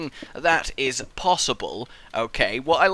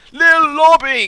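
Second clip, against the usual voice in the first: high, loud call; shouting a name; 10 semitones higher, 9 dB louder, slower than usual. Real, not acted. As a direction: yell the name to get his attention. Calling to other player.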